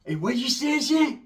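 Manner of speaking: high, rising taunt building to a yell; a hammed-up high tough-guy challenge; mock challenge. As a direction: play the cocky fighter daring them. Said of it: "That motherfucker said".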